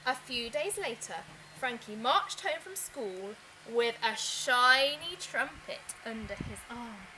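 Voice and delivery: ordinary delivery, nothing marked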